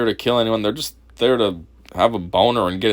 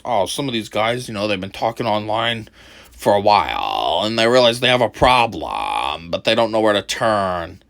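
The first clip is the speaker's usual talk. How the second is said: mocking Chris; 4 semitones lower than usual. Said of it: "sounds like he's making excuses".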